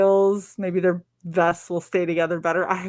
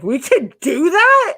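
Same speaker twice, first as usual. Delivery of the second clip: high, slow disbelieving question; stunned at the news; surprised; 10 semitones higher, 6 dB louder, slower than usual. Retold or imitated. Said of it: "I'm like".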